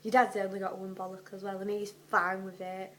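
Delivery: fast, loud telling-off; loud scolding mum. Pretend. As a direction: rattle off a mum's rant. Continuing mock Mrs Weasley rant.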